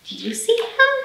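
put-on high, teasing ask to the kids; playful question. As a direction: coax the children to look. Asks children to find Horace.

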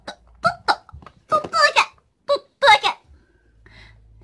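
very high squeaky cute voice. Pretend. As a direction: squeak it out, slowing at the end. Dabbing shadow, cute voice.

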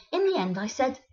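ordinary delivery

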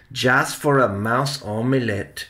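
plain reading voice

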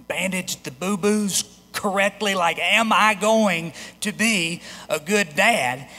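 ordinary delivery

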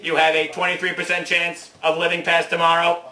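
ordinary delivery

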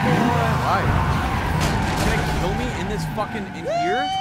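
high, loud disbelieving yelps; shocked outburst. Real, not acted. Streamer shocked at dying, outraged yelps.

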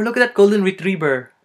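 high, loud "retriever" said as "retrieber"; Filipino-accented "retriever", as "retrieber". Shown as a demonstration. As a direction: exclaim it with B for V in "retriever". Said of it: "we confuse the V's and the B's".

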